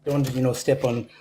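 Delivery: ordinary delivery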